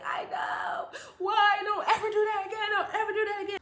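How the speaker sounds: high, pleading scolding; crying mother. Retold or imitated. Mother to child who walked home, tearful pleading. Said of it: "she just crying even more".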